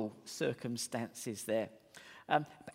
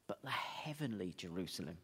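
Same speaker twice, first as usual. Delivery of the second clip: whispered; 6 semitones lower, 7 dB quieter than usual. Real, not acted. Hushed aside in sermon.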